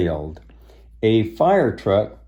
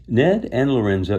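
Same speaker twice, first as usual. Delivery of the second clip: plain reading voice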